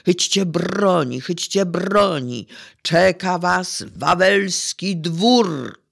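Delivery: loud, urgent dramatic calling; Jasiek's desperate cry. Acted. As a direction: cry out in despair, drawing out the last words. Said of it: "zrozpaczony Jasiek krzyczy". (rozpaczliwy krzyk, głośne wołanie)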